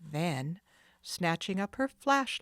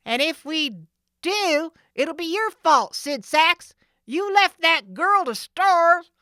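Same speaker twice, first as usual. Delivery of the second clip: much higher, loud, slow accusation; accusing thug; 11 semitones higher, 13 dB louder than usual. Acted. Jake blaming Sid, accusing. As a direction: blame the boss. Thieves quarreling.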